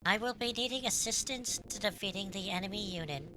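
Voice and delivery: lightly voiced android, formal and even; a hint of a calm combat robot reporting in; understated robot-girl imitation; mimicking Cosmos. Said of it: "that's what I'm mimicking right there".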